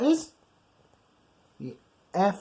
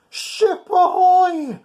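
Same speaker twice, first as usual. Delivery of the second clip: slow, loud, high hail; long drawn sailor's hail; ship ahoy call; 8 dB louder, slower than usual. Acted. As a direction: call it across the sea. Ghost ship hails them.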